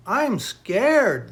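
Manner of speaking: loud, high story-time fright; scared cry. A narrator's reading. Two boys jump up at a howl, acted loud frightened cry.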